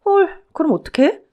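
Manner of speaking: high-pitched gasp of alarm; startled dismay. Acted. Wife learns playing dead fails with bears, startled dismay. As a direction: react aghast, voice jumping high.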